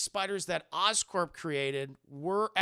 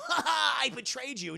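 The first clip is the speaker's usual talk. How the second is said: villain gloat; 7 semitones higher, 4 dB louder than usual. Retold or imitated. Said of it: "the villains don't go".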